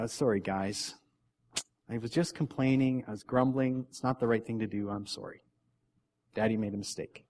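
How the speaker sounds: quiet, humble admission of fault; repentant apology. Acted. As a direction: own up gently, like a dad saying sorry. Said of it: "repenting to them when we're like that".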